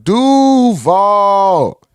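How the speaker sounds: long high DU, then VAL falls down; DUVAL with falling end. Shown as a demonstration. Host demonstrating the full call, DUVAL held then falling. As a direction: stretch the DU and let VAL drop. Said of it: "it gotta fall down".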